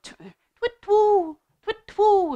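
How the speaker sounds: high hooting twit-twoo; owl hoot. Shown as a demonstration. Showing children the owl call, high hooting. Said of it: "you can do a twit".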